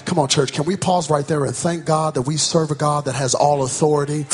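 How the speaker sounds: ordinary talk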